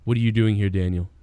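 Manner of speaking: low, steady radio alert; deep, controlled, no panic; composed authority. Shown as a demonstration. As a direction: demonstrate a calm chief's order. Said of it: "It would go something like that".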